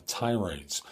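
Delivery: ordinary talk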